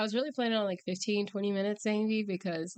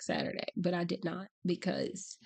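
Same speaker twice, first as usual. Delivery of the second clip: ordinary delivery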